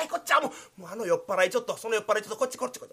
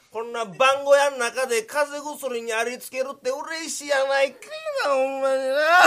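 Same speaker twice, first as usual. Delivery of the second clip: put-on blubbering, louder and higher with drunken happiness; tearful joy; 5 semitones higher, 4 dB louder than usual. Drunk guard sobbing with joy over cold medicine. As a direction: choke up over the drink, half sobbing.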